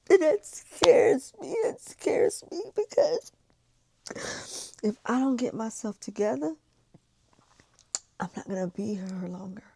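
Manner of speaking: high, tearful and shaking; on the edge of sobbing; crying. Real, not acted. Fears not surviving, crying.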